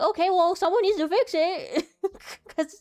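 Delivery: high and loud, with a laugh breaking through; mock-frustrated order to IT, slowing at the end; exasperated demand. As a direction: push hard that someone must fix it. Retold demand to fix it.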